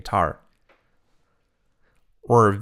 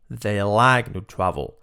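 normal talking voice

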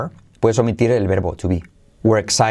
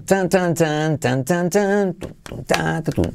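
hummed guitar riff; 5 semitones higher than usual. Retold or imitated.

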